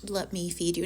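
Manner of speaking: lightly voiced tender lover; soft tender offer. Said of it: "he's just like".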